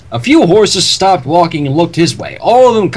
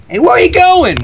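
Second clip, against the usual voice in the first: high calling pony; 13 semitones higher, 4 dB louder than usual. Acted. Pony calling after Larry.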